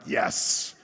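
hissed, triumphant little yes; retold private cheer, half laughing; gleeful relief. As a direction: celebrate it with a long sibilant finish. Gleeful reaction to the correction.

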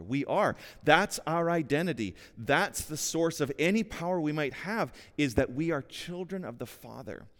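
full-force preaching. Real, not acted. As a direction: preach it out with conviction. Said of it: "preaching at full force".

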